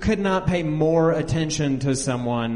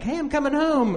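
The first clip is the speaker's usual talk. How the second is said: much higher breezy sing-song; cheery mock self; 14 semitones higher than usual. Calling mom to announce coming home, breezy high. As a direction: toss it off lightly to mom. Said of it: "toyed with her emotions".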